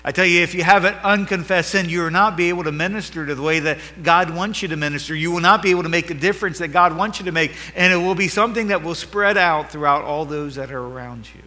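fired-up sermon delivery; preaching at full force. Acted. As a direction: build it up like a revival preacher. Preacher exhorts congregation.

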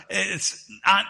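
ordinary delivery

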